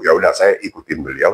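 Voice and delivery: ordinary delivery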